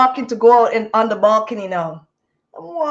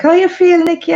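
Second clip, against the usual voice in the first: higher, louder teasing check-in; friend's playful question, higher; friend asking; 6 semitones higher, 6 dB louder than usual. Retold or imitated. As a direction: ask how she feels, amused.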